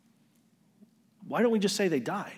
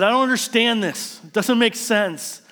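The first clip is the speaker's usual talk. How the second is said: higher, louder anguished cry; a loud raised protest, slowing on the last words; yelling at God; 6 semitones higher, 5 dB louder than usual. Retold or imitated. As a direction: throw it up at the sky in frustration. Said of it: "I yelled at God".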